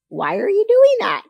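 high, loud disbelieving squeal; mock incredulous. Mocking a pregnant woman eating peanut butter on fish, loud high incredulous. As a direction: shriek it as if baffled by the craving.

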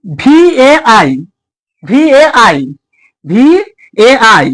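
letters ভিএআই drawn out very slowly; spelled out slowly. Shown as a demonstration. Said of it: "যেমন দিয়েছে দেখো". (ভিএআই ধীরে ধীরে বানান করে)

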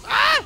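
very high, loud scream of alarm; panicked scream. Real, not acted. Gamer who can't see the screen, panicked scream. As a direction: scream like you just lost your sight. Blinded, losing the fight.

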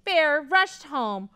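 ordinary delivery

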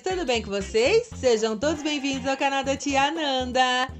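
put-on high, chirpy kids' channel greeting; sing-song cheerful hostess voice; bright squeaky sing-song welcome. Kids channel cheery intro.

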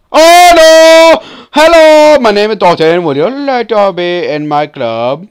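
very high, loud shriek easing into a loud hammed-up greeting; shriek, then introduce yourself still yelling; mock panicked yelling. Sudden alarm turns into mock greeting.

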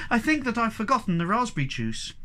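high-pitched dismayed outburst; bursting out. A narrator's reading. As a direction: confess it in a flustered rush of dismay. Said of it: "she burst out".

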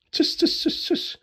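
breathy hissed giggling; stifle a giggle through the teeth; hissing giggle. A narrator's reading. Elf father giggling at Santa, hissed giggle. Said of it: "fiel vor Kichern fast vom Stuhl".

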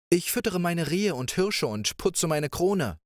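playful princess. Acted. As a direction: coax him like a carefree young princess. Princess answers.

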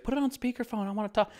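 put-on high old lady voice; quirky old lady.

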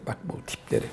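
whispered and scornful "bu tipleri"; contemptuous whisper. Real, not acted. To his followers, sneering quietly at these influencers. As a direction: hiss it out under his breath.

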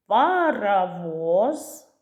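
"паровоз" drawn out slowly, each syllable stretched. Shown as a demonstration. Teacher giving children a word to clap, "паровоз" slowly by syllables. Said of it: "Скільки в цьому слові складів".

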